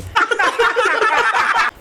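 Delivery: high giggling laugh; breaking into laughter. Real, not acted. Laughs at the joke. (हँसते हुए)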